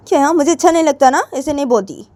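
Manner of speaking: loud, high, quick objection; irritated retort. Acted. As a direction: cut them off in annoyance. Acted quarrel, snappish objection. (झुंझलाकर तेज़, ऊँची आवाज़ में)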